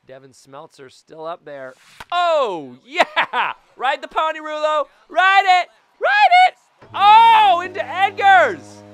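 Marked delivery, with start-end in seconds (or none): 2.1-8.8 much higher, loud cheering, pushing the ball out; excited home run cheer. Real, not acted. Gamer cheering his hit over the fence, ecstatic yelling. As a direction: scream for the ball to carry out.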